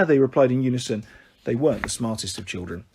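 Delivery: plain reading voice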